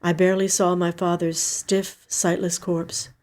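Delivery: plain reading voice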